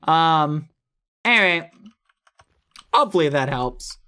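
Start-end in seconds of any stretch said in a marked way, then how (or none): none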